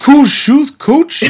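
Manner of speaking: high, loud mock kid voice; hammed-up kid. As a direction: squeal it like an eager student. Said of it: "one of your bastard kids hit you up".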